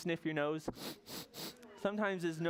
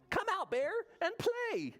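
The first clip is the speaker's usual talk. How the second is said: very high, eager playmate voice; friends calling. Acted. Bear's friends invite him out, high calling voice. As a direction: call him out to play in a high pipe. Friends call Bear out to play.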